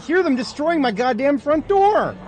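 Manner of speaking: high, loud enraged shouting at the enemies; real rage, yelling at the screen; furious yelling. Real, not acted. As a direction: scream your fury at the raiders who broke in. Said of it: "Sorry for getting salty".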